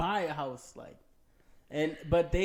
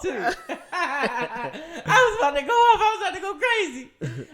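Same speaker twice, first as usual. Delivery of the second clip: very high, loud cry through laughter; laughing outburst; 10 semitones higher, 7 dB louder than usual. Real, not acted. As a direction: wail it out while cracking up. Laughs over lost train of thought.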